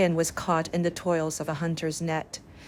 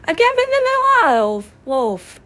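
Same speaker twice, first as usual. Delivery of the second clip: very high, loud drawn-out cry of alarm; high-pitched full-voiced yell; shouting; 11 dB louder, slower than usual. A narrator's reading. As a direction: holler it high and long, as if calling a whole village. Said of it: "the shepherd boy again shouted".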